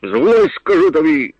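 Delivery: high furious yell up the tree; angry shout. A narrator's reading. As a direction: bellow it, red with rage. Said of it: "він закричав, багровіючи від злості". (розлючений крик угору)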